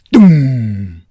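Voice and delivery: loud, drawn-out boom; booming explosion. A narrator's reading. Narrator sounding the gallery explosion, a booming shout. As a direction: bellow the blast, letting it fall away. Same explosion heard by Don.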